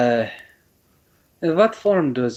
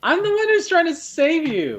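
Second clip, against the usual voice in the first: mock indignation. Real, not acted. Protesting the French fries ban.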